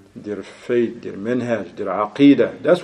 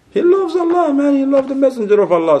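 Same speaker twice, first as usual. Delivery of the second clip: much higher, insisting he loves Allah; high pleading exclamation; 12 semitones higher than usual. Acted. Preacher protests to the congregation, high exasperated exclamation. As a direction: cry it out high in disbelief.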